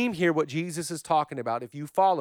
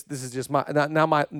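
ordinary talk, nothing marked

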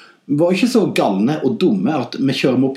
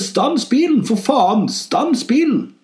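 high, drawn-out mock yell; urgent high-pitched cry from inside the head; shouting inner voice; 9 semitones higher, slower than usual. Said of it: "en stemme i hodet som roper".

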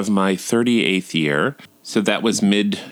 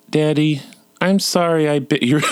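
imitated little boy, pitched up; higher, level child's apology; small son's voice; 6 semitones higher than usual. Son apologising to daddy, high child voice. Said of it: "And then he said".

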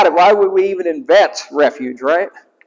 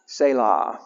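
hushed selah; 5 semitones lower, 7 dB quieter than usual. Acted. Repeated pause word.

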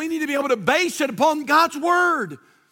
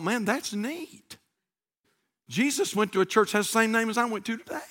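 soft, lowered private thought; naive musing; 4 semitones lower, 7 dB quieter than usual. Retold or imitated. Newcomer misunderstands Calvary as her church, soft naive musing. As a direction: muse to yourself, innocently impressed.

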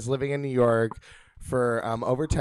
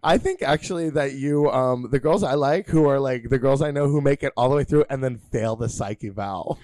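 ordinary delivery

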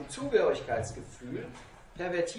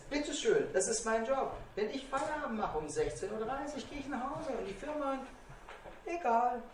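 higher, lightly voiced son; son's breezy retort; 5 semitones higher than usual. Son tells his father why his job is better, higher breezy retort. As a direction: toss it off to Papa in a higher voice. Quotes son contrasting their jobs.

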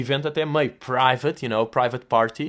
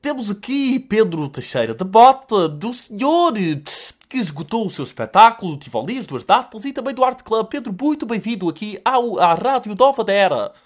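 stuffy radio voice; 6 semitones higher, 4 dB louder than usual. Retold or imitated. Host switching to his on-air voice to welcome the guest, stuffy announcer. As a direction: ham up the stuffed-up DJ voice. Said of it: "a voz de rádio dele é uma voz constipada".

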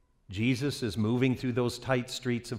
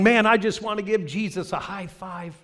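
eager fan; 8 semitones higher than usual. Acted. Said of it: "Others are going".

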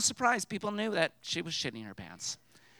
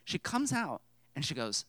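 ordinary talk

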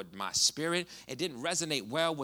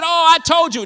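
much higher and louder, exultant yell; loud triumphant cry; 17 dB louder than usual. Retold or imitated. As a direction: shout it out in wild joy. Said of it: "you talk about a loud, wild Puerto Rican".

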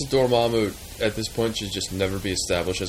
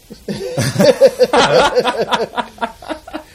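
high burst of laughter; 14 semitones higher, 8 dB louder than usual. Real, not acted. Laughs at copyright joke.

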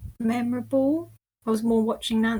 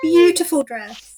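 loud, high, slow gush; excited admiration; 7 semitones higher, 11 dB louder, slower than usual. Real, not acted. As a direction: gush over the dress. Admiring dress on screen.

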